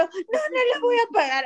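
very high, tearful mock whine; whining child. Child refusing mom's order to turn off the camera, whining. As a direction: protest like a crying little kid. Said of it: "Y el otro".

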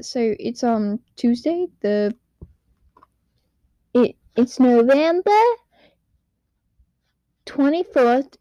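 ordinary talk

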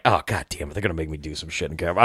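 low, soft, fast muttered dread; low and soft, hurried inner grumble. Retold or imitated. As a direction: grumble it under your breath, rushing.

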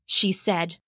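ordinary delivery

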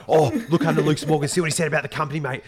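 high, loud eager voice; excited. Retold or imitated. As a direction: point it out, bursting with news. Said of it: "And he's like".

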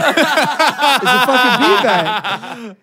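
slow, drawn out through laughter; laughing. Retold or imitated. Telling friends about the beanbag, slow and laughing. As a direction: laugh it out slowly in disbelief.

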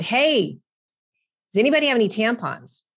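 loud, high and fast, calling out across the shop; said really loudly. Retold or imitated. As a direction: call it out loud for everyone to hear. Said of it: "And I said it really loudly".

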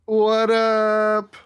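big, drawn-out high shout; hyped greeting. Real, not acted. Guest greets the hosts as he is introduced, hyped. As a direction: belt out a long, loud hello.

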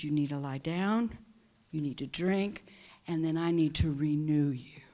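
low, hushed instruction; soft whisper. Acted. God telling the weary to rest, softly whispered. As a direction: murmur it tenderly.

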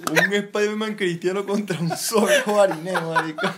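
cracking up at the picture he paints; laughing. Real, not acted. Burla entre amigos riendo. (riéndose a carcajadas)

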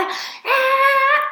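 high mock party whoop, imitating a fake party guest; sudden party whoop. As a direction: yelp it out like someone who just jumped up to film. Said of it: "y de repente se para".

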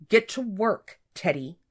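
firm, loud order settling down; forced composure; straining for control. A narrator's reading. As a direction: rein in your temper as you order. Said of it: "working hard to keep her voice level".

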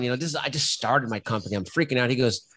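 ordinary talk

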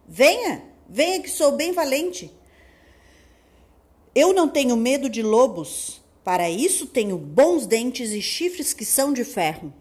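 brave shouting big goat; bold loud challenge. Acted. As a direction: defy the wolf loudly. Big goat defies wolf. (desafio corajoso e forte)

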